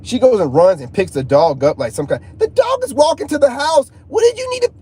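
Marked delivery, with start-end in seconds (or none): 2.4-4.7 high-pitched, incredulous outburst; exasperated protest. Retold or imitated. Man retells his disbelief at her picking up the dog, exasperated.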